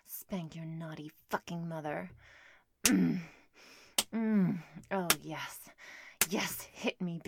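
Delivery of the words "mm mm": loud moaning hums; loud moans. Acted. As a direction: moan loudly, lips closed. Moaning in roleplay.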